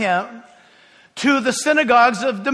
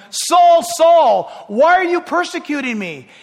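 voice from heaven; 6 semitones higher, 5 dB louder than usual. Acted. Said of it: "heard a voice saying to him".

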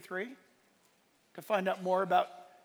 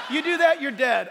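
loud, high, laughing warning; mock threat; 6 semitones higher, 8 dB louder than usual. Pastor retelling his own tough warning, laughing. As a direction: play the tough guy. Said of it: "you don't go like this".

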